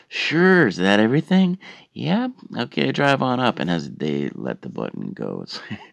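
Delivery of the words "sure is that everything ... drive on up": high, loud chirpy cashier voice; perky worker over the drive-thru box; drive thru speaker. Retold or imitated.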